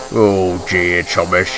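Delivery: a hint of a slurred, stuffy boss; lightly voiced Fat Controller. A narrator's reading. Fat Controller finds damaged Thomas, lightly slurred dismay.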